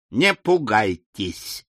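slow, gentle reassurance; calming. A narrator's reading. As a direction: ease her fear, slowly. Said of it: "сказал Том Смарт". (медленно и успокаивающе)

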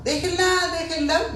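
high-pitched mock cry from afar; retold shouting. Relative calling to police to release her, retold shouting. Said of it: "a una cuadra y gritando".

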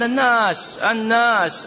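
each الناس drawn out slowly, pitched high and falling; stressed repetition. Shown as a demonstration. The teacher stresses الناس to mock following the crowd. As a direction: lean on الناس, stretching it high then letting it fall. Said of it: "الناس كلهم يقولوا كده".

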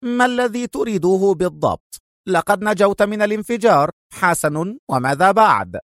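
irritated, high-pitched demand; angry. A narrator's reading. Rival rounding on Adham, angry. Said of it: "في غضب قائلا".

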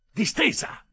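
high, loud snapped order; one-word shouted order; sharp command. Acted. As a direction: snap it down at her. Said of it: "dissi e la spinsi giù".

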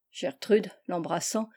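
ordinary delivery, nothing marked

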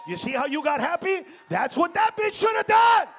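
high, full-volume rant at the crowd; exasperated shout. Real, not acted. Yells at audience after calm Spanish.

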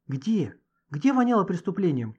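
startled outburst, acted lightly; frightened. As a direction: ask it in sudden alarm. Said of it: "испугалась Маша".

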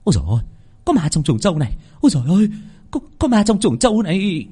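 terror-struck. Acted. Mistress finding a body in the shed, terrified. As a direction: stammer in fright, building to a high wail. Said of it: "Bà Chánh kinh hồn táng đờ".

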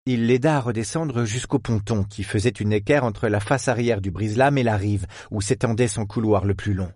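ordinary delivery, nothing marked

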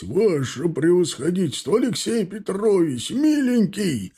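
high, joyful cry of welcome, stretching the last word; overjoyed cry. Acted. Old soldier greeting his returned general, high joyful cry. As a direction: cry out in delight at the returned commander. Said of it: "закричал, выскакивая наружу, Дубков".

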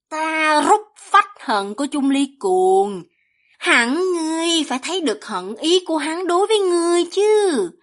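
high, theatrical villainess voice; taunting demon villainess. A narrator's reading. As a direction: goad the listener, drawing out the phrase endings.

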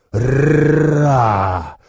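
huge roaring dragon cry. Acted. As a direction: let out a giant dragon roar. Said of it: "Дракон заревел".

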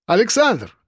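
high-pitched hail by name; hailing him, pitched well up; calling out. A narrator's reading. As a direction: call to someone in a queue. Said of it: "окликнули меня".

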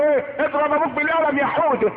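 put-on high, playful parent cheering on a toddler; egging-on parent. Mother urges little Houda to slap his father, egging on. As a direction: goad the little one in a high voice. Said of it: "هو صغير تقول له".

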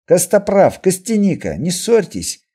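high, loud call to order; teasing scolding. Acted. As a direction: step in loudly to stop the bickering. Leader breaks up a quarrel. (громко одёргивая спорщиков)

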